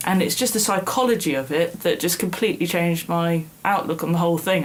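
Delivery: normal talking voice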